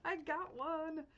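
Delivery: high, drawn-out gleeful squeal; retold fangirl squeal, stretched out high; excited squeal. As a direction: squeal it slowly with over-the-top glee. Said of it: "and so I was like".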